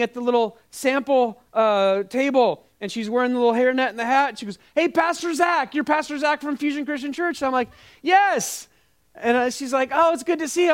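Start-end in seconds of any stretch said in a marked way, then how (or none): 4.7-7.4 high-pitched imitation of an eager lady; excited greeter. Sample lady recognizing her pastor in Costco, high and eager. 8.0-8.7 very high, over-polite retold yes; awkward high yes. Pastor answering a stranger who recognized him, high and awkward. Awkward reply to the sample lady. 9.9-10.7 high-pitched imitation of a friendly lady; friendly greeter. Sample lady greeting her pastor, high and friendly.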